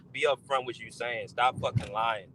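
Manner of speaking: normal talking voice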